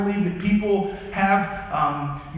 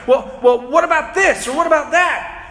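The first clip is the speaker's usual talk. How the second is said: mock objection; 6 semitones higher, 4 dB louder than usual. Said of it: "They're gonna be like".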